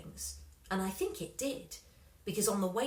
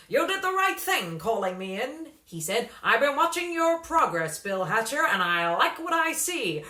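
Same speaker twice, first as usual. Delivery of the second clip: loud slick manager; 3 semitones higher, 8 dB louder than usual. A narrator's reading. Slingsby voiced by narrator.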